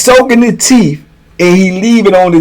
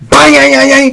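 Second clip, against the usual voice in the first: high-pitched vocal clanging; imitated table banging; 8 semitones higher than usual. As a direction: mimic a steel table being pounded. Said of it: "he hit that table".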